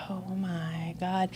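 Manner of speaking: slow, whispered disbelief; awed whisper. Retold or imitated. Realizing her own responsibility, awed whisper. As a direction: breathe it out, floored by the truth.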